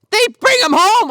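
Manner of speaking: high, loud incredulous exclamation; loud disbelief. Real, not acted. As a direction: burst out in disbelief at the absurdity. Incredulous punchline about absurdly fast police.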